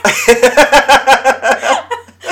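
long, loud, high peal of laughter; hard laughter. Real, not acted. Host loses it over the nickname joke, laughing hard. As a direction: laugh helplessly and loudly.

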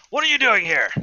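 high, loud shout at the intruder; angry squatter. Acted. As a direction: bark it like a cornered squatter. NPC confronts intruders.

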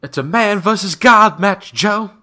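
high, loud, stretched-out sing-song; Bray Wyatt. Acted. Co-host correcting the match name to his friend, sing-song Bray Wyatt impression. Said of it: "kinda got sing-song".

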